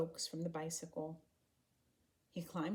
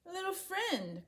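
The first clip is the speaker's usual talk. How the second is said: much higher, slow friendly call; 13 semitones higher, slower than usual. A narrator's reading. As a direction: call to the little girl from a distance. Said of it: "Condi called as he came nearer".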